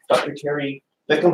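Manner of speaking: ordinary talk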